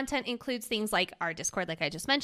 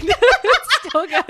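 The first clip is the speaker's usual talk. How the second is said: laughing; 9 semitones higher, 10 dB louder, much slower than usual. Real, not acted. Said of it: "repeats it, laughing".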